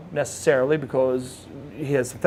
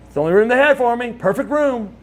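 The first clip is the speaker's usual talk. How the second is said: higher, mildly acted delight; delighted patient; 7 semitones higher than usual. Paralyzed Lex pleased with his room number, delighted. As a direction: marvel at it in a raised voice.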